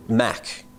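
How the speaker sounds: Mac drawn out slowly, ending in a K; correcting pronunciation. Shown as a demonstration. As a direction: say "Mac" slowly with a clear final K. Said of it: "With a K".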